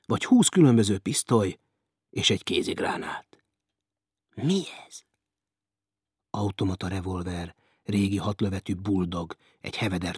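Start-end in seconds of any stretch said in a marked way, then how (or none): 4.3-5.0 startled whisper. A narrator's reading. Doctor alone finds hidden pistols.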